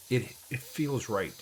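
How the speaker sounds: ordinary delivery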